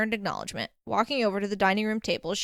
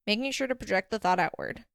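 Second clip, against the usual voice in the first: ordinary delivery, nothing marked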